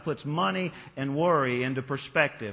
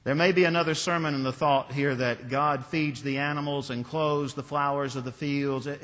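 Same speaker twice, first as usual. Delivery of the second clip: ordinary talk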